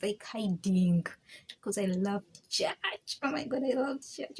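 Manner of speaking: usual speaking voice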